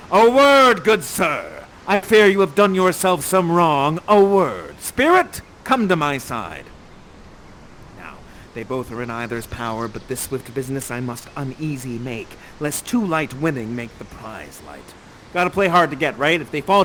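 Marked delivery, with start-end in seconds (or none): 0.1-6.7 louder, commanding father; stern Prospero. Acted. Host as Prospero confronting Ferdinand. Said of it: "and he says". 8.0-14.9 much lower, softer plotting voice; scheming aside. Acted. Prospero quietly confiding his scheme to the spirit.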